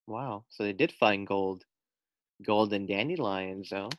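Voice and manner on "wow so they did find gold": usual speaking voice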